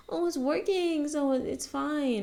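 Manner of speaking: high, slow, falsely cheery self-talk; sing-song "it's fine" denial; mock reassured thought. Talking yourself into a broken thing, mock cheery.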